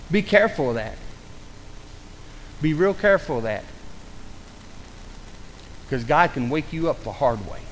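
low, hushed, solemn warning; grave warning. Real, not acted. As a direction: drop low and soft, letting each warning sink in.

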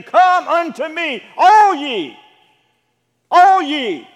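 loud, high preacher's cry; booming, slow summons with ringing ALL; calling out. Acted. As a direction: call the crowd in at full voice. Preacher voicing Jesus calling out.

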